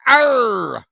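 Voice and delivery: loud, high pirate growl; pirate arr. Pretend. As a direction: give a hearty pirate arr. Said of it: "you can become a B M R pirate".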